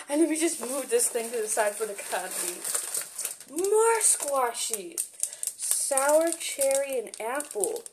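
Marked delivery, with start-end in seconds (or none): none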